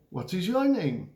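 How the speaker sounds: "What is your name?" with a high swell on "your name"; question intonation. Shown as a demonstration. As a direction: lift the tone on "your name" to ask. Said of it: "usually your tone goes up a little bit".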